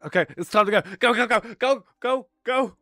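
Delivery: loud, high, hurried calls to run; yelling at the party to get moving; urgent shout. Acted. Player yelling at the party to flee, loud urgent shout.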